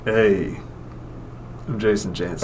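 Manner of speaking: low, soft deadpan introduction; Jason Chancey impression. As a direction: drop pitch and volume for a minimal impression. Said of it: "do an impression of Jason Chancey".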